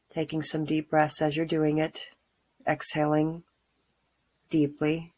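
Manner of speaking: calm grounding exercise. Acted. Guided grounding meditation.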